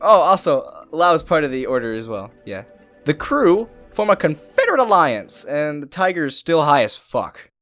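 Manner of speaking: normal talking voice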